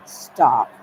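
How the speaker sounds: loud, final stop; shouted stop. Retold or imitated. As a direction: cut everything off with a shout. Retold command after list.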